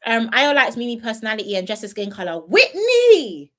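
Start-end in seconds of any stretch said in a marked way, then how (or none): 2.4-3.5 loud, high call of the name Whitney. Real, not acted. Streamer calling out to a chat viewer, loud high call. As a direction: shout the name across the room like spotting a friend.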